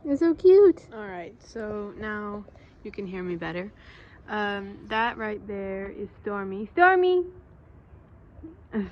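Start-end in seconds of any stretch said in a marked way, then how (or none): 0.0-0.8 high, loud delighted cry; gushing. Real, not acted. Gushing over cat. 6.7-7.2 high, loud name call. Real, not acted. Calling the cat.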